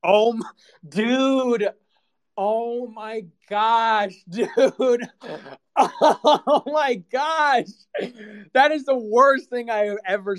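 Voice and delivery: slow, high, breaking into laughter; can barely get the words out from laughing; helpless laughter. Real, not acted. Cracking up at the clip.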